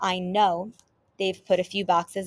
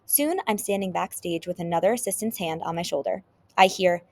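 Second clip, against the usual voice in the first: ordinary delivery, nothing marked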